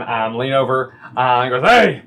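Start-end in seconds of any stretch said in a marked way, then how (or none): 1.7-2.0 lightly voiced rough tavern fellow; rough man. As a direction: give a hint of a hearty rough man. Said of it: "kind of rougher looking man".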